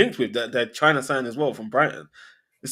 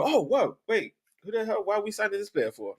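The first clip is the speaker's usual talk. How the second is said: puzzled disbelief; 9 semitones higher than usual. Acted. Acting fans' puzzled reaction to a signing.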